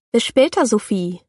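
put-on boy calling goodbye on his way into the changing room. A narrator's reading. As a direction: call it after her as he leaves. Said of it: "ruft er".

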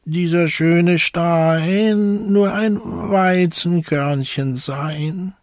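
a hungry little hen musing slowly; hen's voice. A narrator's reading. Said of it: "sprach es".

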